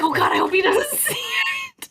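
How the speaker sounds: high, laughing panicked squeal. Retold or imitated. Mom hopes officer misses cracked windshield, high laughing squeal. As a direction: squeal the worry through laughter. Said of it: "I was like".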